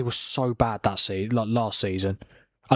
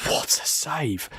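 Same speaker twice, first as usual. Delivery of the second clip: breathless whispered awe, slow; awed whisper; 4 semitones higher, much slower than usual. Real, not acted. Streamer watching the keeper's save, whispering in awe. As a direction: hush it out like you can't believe it.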